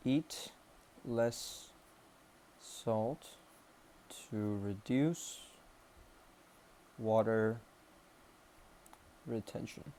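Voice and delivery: slow, halting, word by word; dictating while writing. Real, not acted. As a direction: leave gaps as your pen catches up. Says words while writing them.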